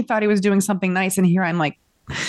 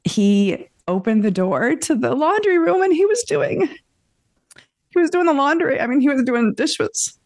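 close to tears; 8 semitones higher than usual. Real, not acted. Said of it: "I could almost cry".

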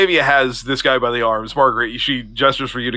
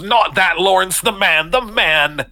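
higher-pitched, insistent correction; exasperated correction; 8 semitones higher, slower than usual. Acted. Octavia corrects Lawrence as she holds the officer, exasperated. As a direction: correct him urgently, pitched up.

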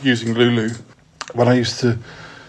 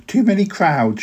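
higher, whiny grumbling mother; mother moaning; 7 semitones higher than usual. Acted. Mother moans about London crowds, high and whiny. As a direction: moan it like a fed-up mother. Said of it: "she moaned".

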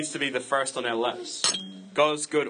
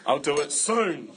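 wrong tone; slower than usual. Acted. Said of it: "it's been in the wrong tone".

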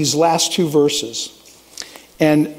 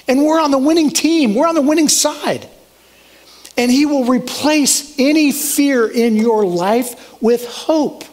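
rising preacher fervor; 6 semitones higher than usual. Real, not acted.

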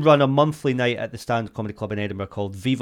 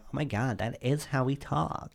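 softer, lightly imitated Valley Girl accent; 10 dB quieter than usual. Girls reacting to the accent, lightly done Valley Girl. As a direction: quote them in a mild Californian girl accent. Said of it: "like, a Valley Girl accent to these girls".

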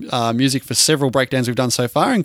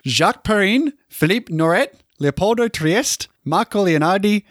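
put-on Italian accent; 4 semitones higher than usual. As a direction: cast names in a hammed-up Italian accent, slow on Leopoldo. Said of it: "gotta break the Italian out again".

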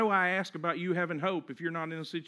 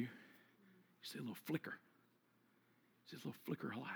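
barely audible, low hush; near-whispered low narration; hushed storytelling; 8 semitones lower, 22 dB quieter, much faster than usual. Acted. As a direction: paint a faint glimmer very softly. Describing faint light in darkness.

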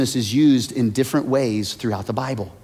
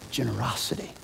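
whisper; 3 semitones lower, 14 dB quieter, faster than usual. Acted. Whispered punchline word.

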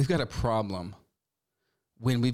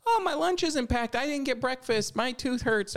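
put-on much higher, whining child voice; host mimicking a child's morning complaints to the parent, whiny and high; whiny kid; 13 semitones higher than usual. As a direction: pitch up into a complaining little kid. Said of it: "Kids that are like".

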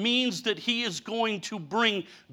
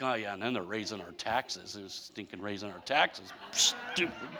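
grumbling; 12 semitones lower, 12 dB quieter than usual. Retold or imitated. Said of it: "and then I was like".

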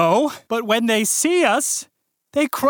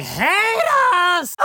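sobbing outburst; 11 semitones higher than usual. Acted. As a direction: burst into hammy sobs. Dean breaks into sobbing.

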